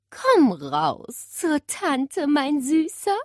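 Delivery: put-on very slow, sweet coaxing old-lady voice. Great-aunt coaxing parrot from under covers, slow sweet coaxing. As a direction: lure the bird out gently, slowly. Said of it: "lockte sie".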